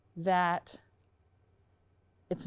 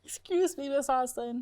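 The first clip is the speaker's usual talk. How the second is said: yawning; 6 semitones higher, 3 dB louder than usual. Real, not acted. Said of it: "You are yawny".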